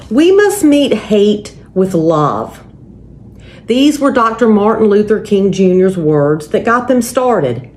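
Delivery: usual speaking voice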